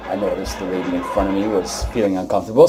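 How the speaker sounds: ordinary talk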